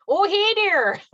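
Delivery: high, loud northern Minnesota "Oh, hey there"; Duluth accent. Retold or imitated. Sister's hometown greeting, done in a cheery northern Minnesota accent. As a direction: greet someone the Iron Range way. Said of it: "the saying is".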